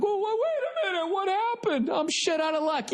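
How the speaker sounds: very high, squeaky imitation of dismay; mock panicked homeowner. Comedian mocks buyers who missed the fine print, in high squeaky dismay. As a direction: squeal it like a hapless dupe.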